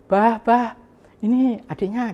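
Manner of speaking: high-pitched calling out; retold shout. Sister-in-law calling Bah about his younger brother, imitated shout. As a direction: imitate her calling out excitedly to her husband. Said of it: "Akhirnya dia teriak".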